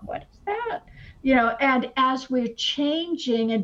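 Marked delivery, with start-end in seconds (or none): 0.0-0.8 puzzled. Retold or imitated. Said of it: "It's like".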